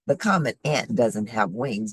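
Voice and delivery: normal talking voice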